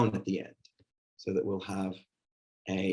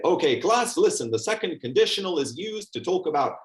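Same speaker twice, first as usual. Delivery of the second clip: higher, louder, stiff textbook lecture voice; mock lecturing teacher; 8 semitones higher, 3 dB louder than usual. Shown as a demonstration. Trainer mocking a teacher lecturing the class, stiff and higher. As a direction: parody a dry teacher reciting the grammar rule. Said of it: "I can stand up and say".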